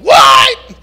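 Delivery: hammed-up angry outburst; furious shout. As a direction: blow up in disbelief. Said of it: "Man, I'm like".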